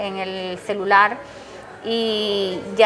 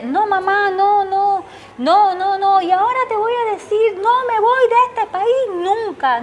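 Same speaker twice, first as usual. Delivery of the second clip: much higher, insistent repeated no's; defiant son; 10 semitones higher than usual. Retold or imitated. Son refusing to leave the country, high and defiant. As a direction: refuse firmly, pitch way up. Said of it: "son's defiant reply".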